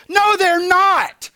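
loud, high, drawn-out contradiction; indignant retort. Acted. Preacher rebutting businesses' hold message, indignant. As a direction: cry out in mock outrage.

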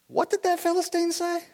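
high, hushed-up mock astonishment; acted disbelieving question; incredulous. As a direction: pitch it way up, taken aback. David hears taunt, reacts.

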